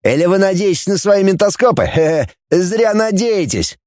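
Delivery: high-pitched defiant taunt; near-shout without real volume; almost shouting. A narrator's reading. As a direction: defy your captors, worked up. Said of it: "почти кричал Фанас".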